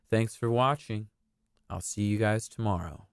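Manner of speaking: usual speaking voice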